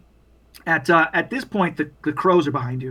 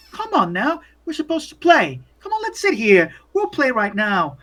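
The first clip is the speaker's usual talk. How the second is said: put-on high-pitched little boy voice; high, eager child inviting play; 6 semitones higher than usual. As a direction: coax like a child wanting a playmate. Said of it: "There's the little boy".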